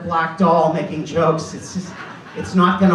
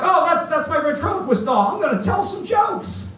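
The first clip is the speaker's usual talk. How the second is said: put-on eager kid; 11 semitones higher than usual. Kid excited about doll.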